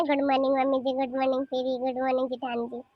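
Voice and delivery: low, sour parody of a greeting; muttered mock good morning; mocking imitation. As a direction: mimic the daughter-in-law's English greeting with scorn. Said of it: "इसकी तो और अंग्रेजी छाट रही है".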